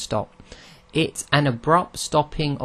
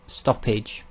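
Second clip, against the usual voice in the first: ordinary talk, nothing marked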